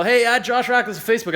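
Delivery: put-on imagined shout to the girls as the elevator doors close; pretend yell. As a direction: call out as doors close, half joking. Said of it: "I thought I should yell".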